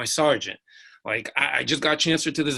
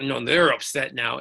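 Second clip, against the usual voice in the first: ordinary delivery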